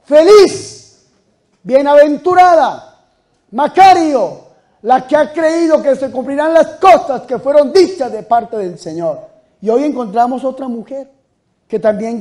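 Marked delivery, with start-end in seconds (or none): 0.0-9.2 high, loud proclamation, slowing on bienaventurada; joyful shout. Acted. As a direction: cry out the blessing with full voice. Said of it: "gritó".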